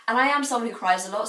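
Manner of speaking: ordinary talk, nothing marked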